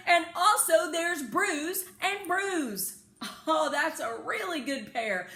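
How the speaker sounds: high, loud and laughing; shouting with excitement. A narrator's reading. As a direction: shout the discovery to your uncle. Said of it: "I began to shout again".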